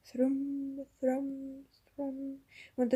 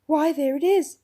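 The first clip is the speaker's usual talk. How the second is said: louder, higher, rousing; excited; 4 semitones higher, 9 dB louder than usual. A narrator's reading. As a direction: wake up with hope. Prince rouses.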